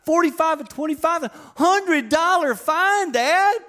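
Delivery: high, slow whining son voice; whiny teen son. Retold or imitated. Son reporting his fine to dad, high whine. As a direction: drag it out like a sulky kid. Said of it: "I'm like".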